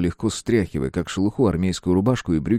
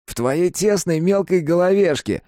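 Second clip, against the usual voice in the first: put-on higher grumbling teen boy; sulky teen mumble; 12 semitones higher, 5 dB louder than usual. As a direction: mutter it like a sulky teenager. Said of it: "буркнул Капустин уже своим подростковым голосом".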